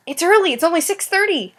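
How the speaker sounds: high, amused exclamation; mock surprise. Real, not acted. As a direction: feign amazement at the early hour. Mocks saying goodnight so early.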